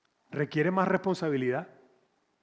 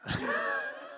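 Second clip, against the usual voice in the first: high laugh; 5 dB quieter than usual. Real, not acted. Laugh after joke.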